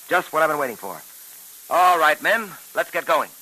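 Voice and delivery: ordinary talk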